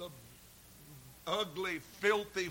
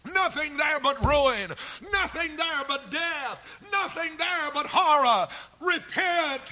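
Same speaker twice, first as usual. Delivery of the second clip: God's loving command; 8 semitones higher, 7 dB louder than usual. Acted. God calling Walter Burrell away from sin, pleading shout. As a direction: call him by name and warn him off sin. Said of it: "God's command is saying".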